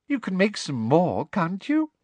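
sweet voice hiding fury; sweetly girlish. A narrator's reading. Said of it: "her voice becoming more sweetly girlish".

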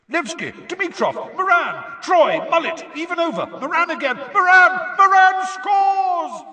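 excited sportscaster shouting the score; live match commentary. Acted. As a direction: commentate breathlessly, exploding on the goal.

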